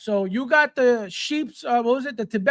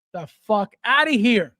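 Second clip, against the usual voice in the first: usual speaking voice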